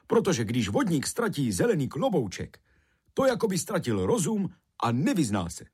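high, lilting character voice; Rumcajs, earnest explaining. A narrator's reading. Rumcajs explaining why the sprite is lost, earnest. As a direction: explain it urgently to a child.

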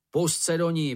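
mild narrated shout of encouragement; crying out. A narrator's reading. Šňupálek urges Mumínek to attack, mild outcry. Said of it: "vykřikl Šňupálek".

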